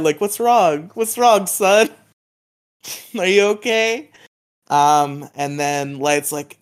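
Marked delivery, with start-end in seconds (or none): 0.2-4.1 higher-pitched worried dad voice; acted parental concern, pitched up. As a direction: play a dad anxiously checking on his son. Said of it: "he's like a concerned dad".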